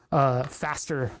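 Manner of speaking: ordinary talk, nothing marked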